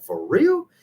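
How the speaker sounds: high, surprised for real. Retold or imitated. Told a fellow saint had the same illness, surprised and excited. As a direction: leap up in disbelief. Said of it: "And I was like".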